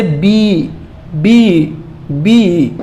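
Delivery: "बी" stretched out like the vowel in seat; drawn-out "बी", each one separate; long ee sound. Shown as a demonstration. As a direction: hold the ee in "बी". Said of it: "जैसे सीट हमने बताया था ना".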